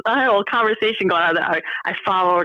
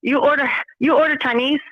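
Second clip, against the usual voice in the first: bad put-on Chinese accent, broken English; clumsy Chinese accent prank call; fake Chinese accent. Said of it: "your Chinese accent is horrible for Chinese".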